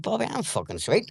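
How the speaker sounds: high-pitched breezy retold reply; imitating his old self. To friend Bobby at his bedside, high mock breezy. Retelling deathbed talk with friend.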